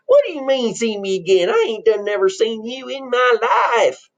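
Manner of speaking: hammed-up country indignation; indignant country bumpkin. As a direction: object like an affronted farm boy, dragging out the end. Plays countrified twin brother.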